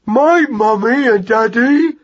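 high, loud, slow mouse wail; agonised cry. Acted. Young mouse hears parents are dead, agonised cry. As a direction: cry out in drawn-out agony. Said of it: "cried Magnus in an agony".

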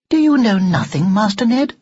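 high, prim reproach; haughty doll. Acted. Doll shocked at Ned's ignorance, haughtily.

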